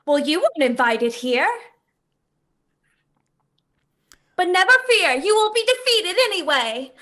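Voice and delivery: high, amplified showy cutesy voice; cutesy stage persona. Acted. Performer defying cultists before her audience, cutesy stage voice. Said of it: "amplified stage persona cutesy voice".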